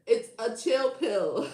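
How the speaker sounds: slow, stretched words through laughter; real tearful laughing delivery; crying with laughter. Real, not acted. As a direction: squeeze the punchline out while cracking up. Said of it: "I'm fucking crying".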